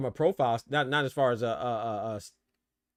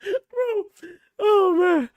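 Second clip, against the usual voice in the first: high squealing laugh into words; helpless laughter; laughing fit; 9 dB louder, much slower than usual. Real, not acted.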